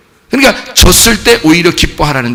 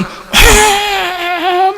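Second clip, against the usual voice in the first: high, long wail; mock hysterical cry; 12 semitones higher than usual. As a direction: imitate someone laughing and crying wildly. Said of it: "막 웃어, 막 울고". (웃고 우는 듯한 높은 외침 흉내)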